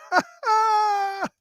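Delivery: a sudden high-pitched laughing squeal. Real, not acted. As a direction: burst into a high-pitched cackle. Laughing at the debate memory.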